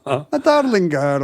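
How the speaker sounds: slow, hammed-up Scottish-Irish brogue; Irish-sounding Scottish accent. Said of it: "those are Irish accents we were just doing".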